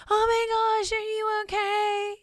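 high, overdone gasp of fake alarm; mock panicked parent. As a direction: squeal it like a fussing, panicking mom. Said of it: "I don't yell out".

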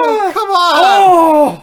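high gasp then loud laughing protest; gasp, then cry out in disbelief; shocked outburst. Acted. Brother testifies against him.

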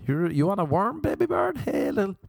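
very high cooing pet-call voice; calling a bird. Pretend. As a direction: coax a little bird in a high voice. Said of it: "Is that how you call a bird?".

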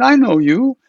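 put-on high, eager old lama voice; excited old monk recognizing a friend; Rinpoche's voice.

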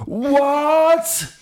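put-on high shouted child voice. A narrator's reading. Girl shouting at her new trousers. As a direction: yell it in shock. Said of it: "råbte Gurli".

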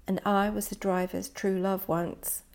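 plain reading voice

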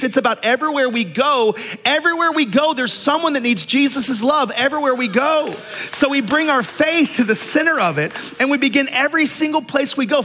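high-pitched, driving preacher delivery; impassioned preaching. Real, not acted. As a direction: preach it to the whole room, urging them on. Preaches with rising force.

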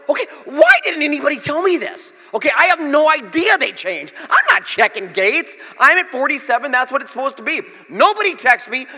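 worked-up retelling of a flustered complaint; flustered outburst. Retold or imitated. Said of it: "I'm kinda flustered".

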